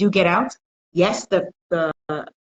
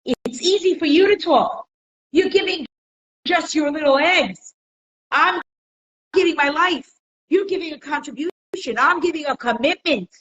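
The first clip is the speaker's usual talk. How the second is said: indignant cow; 8 semitones higher, 3 dB louder than usual. Retold or imitated. Cow scolding the chicken, high and aggrieved. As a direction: protest like a cow who gives its life. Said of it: "He says".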